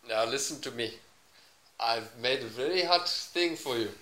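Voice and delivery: put-on slow, deliberate Indian accent; mock-Indian storyteller. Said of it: "give me, uh, oh, Indian".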